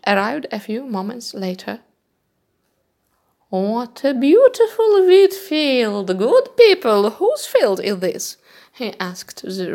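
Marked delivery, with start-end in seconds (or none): 3.5-8.4 higher, louder, slow royal voice; a king delighted by the wheat; admiring king. Acted. King admiring the field and asking the reapers, higher and louder.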